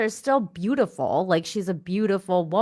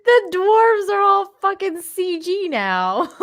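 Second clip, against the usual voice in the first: laughing; 11 semitones higher, 6 dB louder than usual. Real, not acted. Laughing joke about the dwarves.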